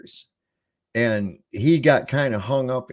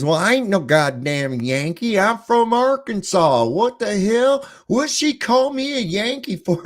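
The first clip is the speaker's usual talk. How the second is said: a southern drawl that climbs higher and louder in outrage; indignant southern drawl; 10 semitones higher, 3 dB louder than usual. Retold or imitated. Arkansas friend protests being called a Yankee, in a rising indignant southern drawl. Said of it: "heavy-duty southern drawl".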